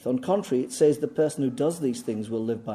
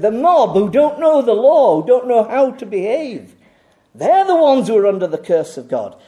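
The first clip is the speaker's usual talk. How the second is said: scornful condemnation; 8 semitones higher, 9 dB louder than usual. Retold or imitated. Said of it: "They said".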